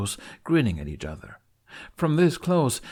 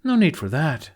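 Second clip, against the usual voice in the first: light teasing retort; a playful, raised quip; quipping; 6 semitones higher, 6 dB louder than usual. Acted. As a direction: toss it off as a quip. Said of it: "Astrid quips".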